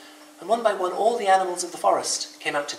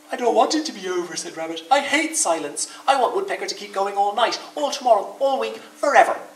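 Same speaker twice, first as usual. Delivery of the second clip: eager pleading; 7 semitones higher, 5 dB louder than usual. Acted. Rabbit begging it continue.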